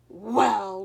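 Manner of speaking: loud gruff troll; growl. Acted. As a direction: growl the word slowly. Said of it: "growled the troll".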